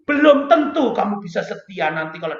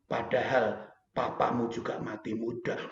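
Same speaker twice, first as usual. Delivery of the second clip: God speaking; 6 semitones lower than usual. Retold or imitated. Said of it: "Tuhan tuh ngejak guyon".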